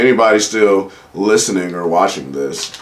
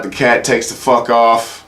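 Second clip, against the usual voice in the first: usual speaking voice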